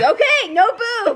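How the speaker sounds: high, startled retraction; mock-alarmed squeak; retold panicked refusal. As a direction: blurt it high as if just nipped. Retold yelped reaction to bite.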